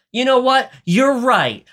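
high-pitched, mock-decisive turnaround; imitated emphatic concession; sudden resolve. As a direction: act out an abrupt change of heart. Acted sudden resolve of the heroine.

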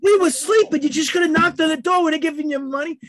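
loud, high retold complaint; acted indignant protest. Friends scolding Ernie over the food, acted indignant shout. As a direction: imitate friends yelling in disbelief.

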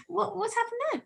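high, puzzled mock double take; retold surprise. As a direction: act baffled at a sudden accent slip. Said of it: "and I was like".

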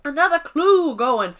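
rally everyone in a put-on Southern accent; hammed-up Southern host; mock Southern accent. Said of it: "[name] won't drop the character".

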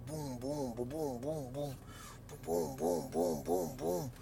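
low rhythmic boom-boom noise; imitated booming. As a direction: voice a steady run of booms.

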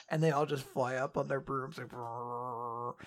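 ordinary talk, nothing marked

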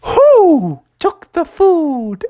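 long high owl hoot; slow, high-pitched owl call. Acted. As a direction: draw it out like a hooting owl. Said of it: "conversation with those owls".

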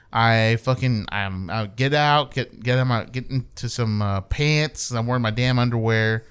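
ordinary talk, nothing marked

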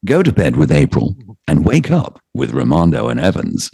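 showy English announcer read, pitch swooping on key words; English-accented radio promo. Acted. DJ voicing a morning-show slogan for K-Rock, English-accented promo read. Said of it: "would be great with an English accent".

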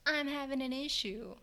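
high mock whine. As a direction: mimic a fussy coworker lightly. Mocking others' complaint.

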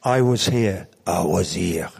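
put-on lightly French-accented, low on the repeat; a hint of a French accent, drawn out. Lightly French-accented, quoting his friend Etienne. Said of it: "I said it in a weird French accent".

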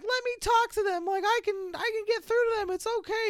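put-on high-pitched pleading boy's voice; mock reassuring plea in a high voice; Eric. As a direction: put on an earnest kid, voice way up. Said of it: "like, Eric's like".

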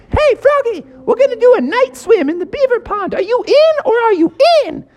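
very high cheerful beaver voice; eager beaver. Acted. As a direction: invite Froggy like a chirpy beaver. Bucky the beaver invites.

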